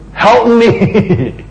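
high, desperate cry of "help me"; pleading woman. Acted.

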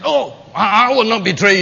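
loud, proud protest from Peter; boastful Peter. Acted. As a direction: Peter swearing loyalty, chest out. Peter's boast voiced loudly.

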